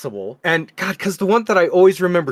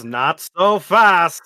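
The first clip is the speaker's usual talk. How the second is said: put-on slow old-timey announcer quote; lightly voiced forties announcer; mock newsreel voice; 3 dB louder, much slower than usual. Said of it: "But President Trump says".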